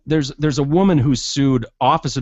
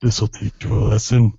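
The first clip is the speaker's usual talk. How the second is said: low, creepy mock threat; lowered voice of a sleazy officer; creepy menace; 4 semitones lower than usual. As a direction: put on a low, menacing come-on. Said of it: "He whispers in her ear".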